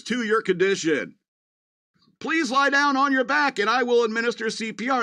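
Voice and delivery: high, chirpy robot delivering bad news brightly; perky assistant announcing a heart attack; cheerful robot voice. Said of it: "the robot's like".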